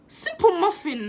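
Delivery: real tears catching on the words; choking up mid-sentence; voice cracking. Real, not acted. To the camera, upset over a shooting, voice cracking.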